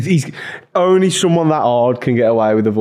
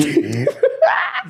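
high laugh. Real, not acted. Laughs at own joke.

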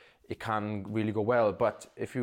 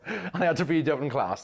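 ordinary talk

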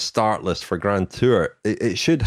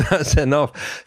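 laughing; 6 semitones higher, slower than usual. Real, not acted. Laughs while cutting off joke.

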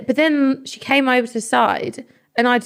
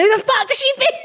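much higher, squeaky indignant voice; mock outraged squeal. Retelling the friend's outburst in a squeaky indignant voice. Ella's friend retold, high voice.

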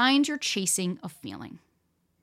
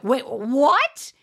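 sharp, louder, higher double take; retold shock; 4 semitones higher, 6 dB louder than usual. To the boyfriend who still wants to date others, shocked. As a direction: blurt it out in disbelief.

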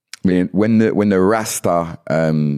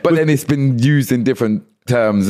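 ordinary talk

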